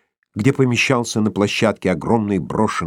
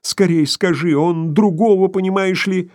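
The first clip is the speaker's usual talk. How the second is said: trembling voice; 7 semitones higher than usual. A narrator's reading. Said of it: "трясущимся голосом".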